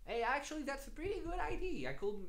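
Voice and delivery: catching a viewer's idea with delight; sudden realization. Real, not acted. Streamer hearing a chat suggestion, pleasantly surprised.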